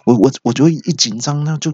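ordinary delivery

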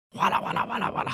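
fast whispered clattering sound; mahjong tile clatter. Retold or imitated. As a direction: rattle it off like shuffling tiles. Officers playing mahjong.

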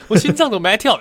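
high, loud mock panic; hammed-up dying panic. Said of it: "acted panic, getting worse".

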